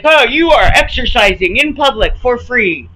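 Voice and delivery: sarcastic imitation of the gym owner, loud and scornful; mocking sarcasm. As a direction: sneer it out like you're quoting his excuse. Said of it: "To be like".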